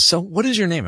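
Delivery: high-pitched imitation of a woman asking; curious question. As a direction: lift the voice, playing the wife. Acting the wife's friendly question.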